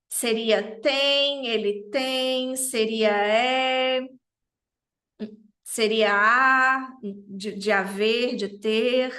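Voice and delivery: slow, drawn out options; slow pondering. Shown as a demonstration. Teacher musing over translations for class, very slowly. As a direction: weigh each word slowly aloud. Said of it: "slow weighing of translation options".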